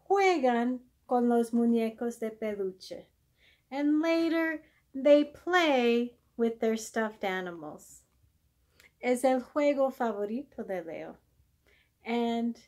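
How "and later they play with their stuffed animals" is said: ordinary delivery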